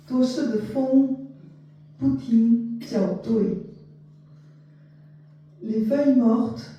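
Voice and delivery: measured poetry reading, spaced line by line; poem recitation. Acted. Translator reading short poems to listeners, poem recitation. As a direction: recite it with deliberate pauses between phrases.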